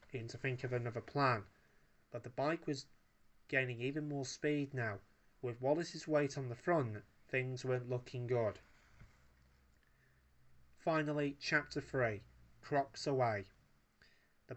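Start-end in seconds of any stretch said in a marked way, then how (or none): none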